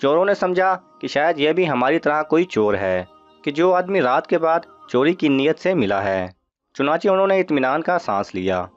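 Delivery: plain reading voice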